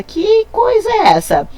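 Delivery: mock a confused pupil; puzzled student. Teacher voicing a puzzled student hearing "jarabe".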